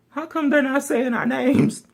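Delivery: high puzzled whine; confused high question. Retold or imitated. Band waiting for awards, puzzled high question. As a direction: sound baffled they're skipping you.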